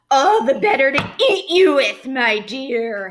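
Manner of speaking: wolf howling. A narrator's reading. Wolf howling at the girl before eating her, loud. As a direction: howl it out before pouncing. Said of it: "howled the wolf".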